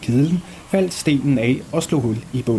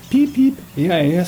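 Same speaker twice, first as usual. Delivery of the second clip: piping call; 6 semitones higher, 4 dB louder than usual. Acted. Boy calls out from hiding.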